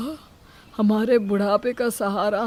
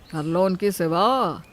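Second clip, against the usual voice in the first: ordinary talk, nothing marked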